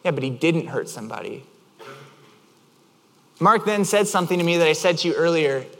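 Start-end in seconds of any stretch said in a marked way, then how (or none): 0.1-1.4 low, softer, unbothered answer; calm reply. Retold or imitated. Friend answering the worked-up driver, calm reply. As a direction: deflate the outburst quietly.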